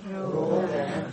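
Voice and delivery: very soft, slow echo of Rodhanam; soft group repeat. Real, not acted. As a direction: murmur Rodhanam back slowly. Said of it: "Say that now".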